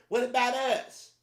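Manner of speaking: high, loud, slow put-on complaint; mock whiny outrage. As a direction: whine it in a mocking falsetto.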